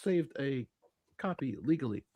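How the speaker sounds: slowed down and dropped to a whisper on legally; sly whispered correction; hushed aside. Pretend. To his co-host, whispering the legal part slyly. Joking about a pirated copy.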